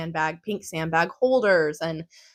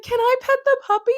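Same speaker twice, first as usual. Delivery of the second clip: put-on much higher, fast, childlike pleading; squeaky fast excited request; eager puppy plea; 4 dB louder, faster than usual. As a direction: ask like a kid who must pet the dog. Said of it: "It's like".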